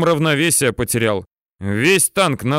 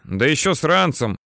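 plain reading voice

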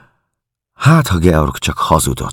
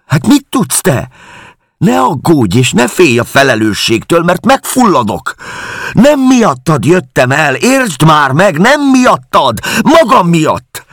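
dühös veszekedés; 13 semitones higher, 5 dB louder than usual. A narrator's reading. Helen vitatkozik, kiabál.

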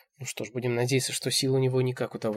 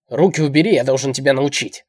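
shouting; 3 semitones higher, 12 dB louder than usual. A narrator's reading. Old man shouts at her to obey, shouting. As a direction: shout it as a harsh lesson. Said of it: "Снова кричит он".

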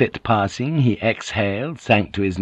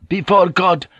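high, loud cutting interruption; erupting anger; 8 semitones higher, 4 dB louder than usual. A narrator's reading. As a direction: snap it back hotly. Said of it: "Joseph erupted".

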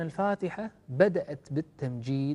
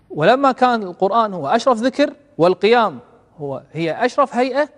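declaiming preacher; 8 semitones higher, 10 dB louder than usual. Acted. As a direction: lift the quote up and hold it. Preacher building to the point.

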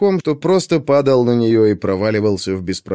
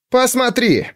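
angry shout; 11 semitones higher, slower than usual. Acted. Alcibiades pointing angrily.

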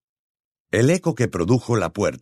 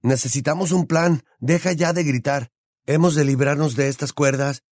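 higher, urgent voice; pitched-up, pressing boy's voice; ordering; 6 semitones higher than usual. A narrator's reading. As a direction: insist tensely over a friend's panic. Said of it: "le ordenó este".

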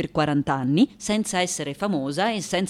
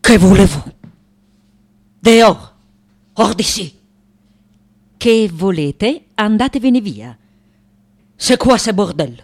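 loud, barked demands to get out; furious artist ordering people out; angry; 9 dB louder than usual. Acted. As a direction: throw intruders out at full volume. Artist throws intruders out.

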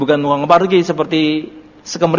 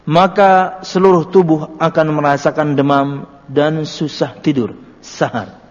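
slow, louder, weighty phrasing; preaching translation; 2 semitones higher, 4 dB louder, slower than usual. Acted. Preacher translating the hadith for listeners, slow and emphatic. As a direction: press each phrase home to the congregation.

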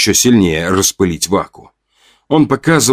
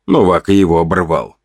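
ordinary delivery

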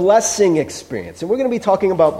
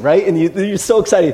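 ordinary delivery

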